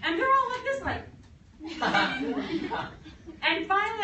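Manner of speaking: laughing through the words; can't stop laughing while telling it. Real, not acted. Narrator laughing at own story.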